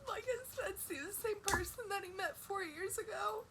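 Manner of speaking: blubbering. Real, not acted. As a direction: let the sobs break the words. Crying about partner's burden.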